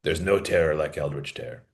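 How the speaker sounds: a hint of a grave storytelling father; low, hushed fireside father voice. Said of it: "Children, gather around at father's knee".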